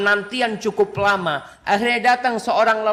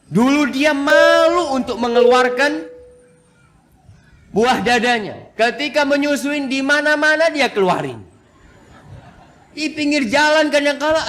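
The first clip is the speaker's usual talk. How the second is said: excited preaching; 7 semitones higher, 4 dB louder than usual. Real, not acted. Preacher builds up, half laughing.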